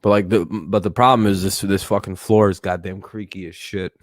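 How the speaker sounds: ordinary delivery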